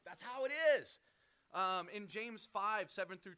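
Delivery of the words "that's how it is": high, raised-voice exclamation; emphatic. Real, not acted. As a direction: push it up high like an exasperated declaration.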